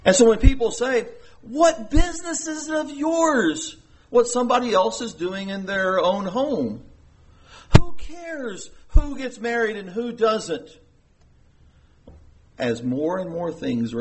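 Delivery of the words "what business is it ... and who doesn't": higher-pitched imitation of a dismissive complaint; mock indignant objector. Preacher quoting people who say mind your business, mock indignant.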